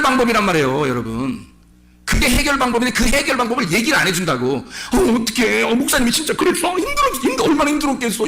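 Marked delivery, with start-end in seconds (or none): 4.6-8.3 high, fussing sympathy in a sugary put-on voice; hammed-up pitying friend; mock sympathizer. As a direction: coo like a gossip taking sides, pitch climbing. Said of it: "이런다고".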